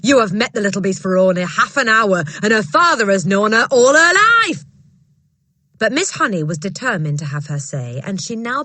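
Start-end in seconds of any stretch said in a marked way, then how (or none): 0.0-4.6 loud, bullying headmistress voice, higher; booming headmistress. Acted. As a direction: bark it down at a timid teacher. Trunchbull voiced in story.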